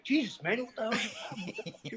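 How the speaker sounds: high, alarmed imitation of the stranger; mock alarmed guy. Mimicking the startled stranger in a high laughing voice. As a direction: squeak it out like a spooked man. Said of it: "He's like".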